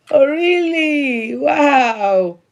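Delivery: high, slow amazed "oh really"; drawn-out delighted surprise; gasping at good news; surprised. Real, not acted. Reacting to a viewer comment.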